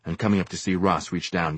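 ordinary delivery

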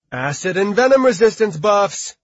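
loud, high called order; calling out. A narrator's reading. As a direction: call out the buffs to the mages. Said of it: "Lucy called out".

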